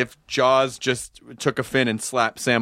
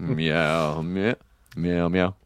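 low cat meow; 9 semitones lower than usual. Acted. Meowing like a cat.

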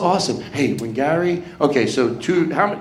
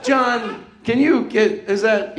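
acted plea, pitched up high; helpless request; 6 semitones higher, slower than usual. Comedian feigning a task so John takes over, helpless request. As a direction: call to John for help in a higher voice. Acting out asking John for help.

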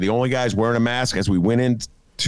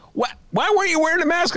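much higher and faster, exasperated disbelief; incredulous demand; faster than usual. Retold or imitated. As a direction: fire it off in stunned outrage. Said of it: "I'm like".

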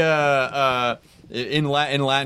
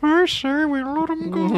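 high squeaky voice; slower than usual. Acted. Joking squeaky line.

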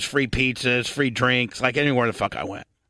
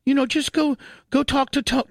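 mock fiancée voice; 12 semitones higher than usual. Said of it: "so she's like".

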